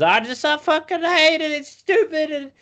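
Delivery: very high, loud whiny dog voice. Retold or imitated. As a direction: whine it out like a bratty moronic dog. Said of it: "He's just".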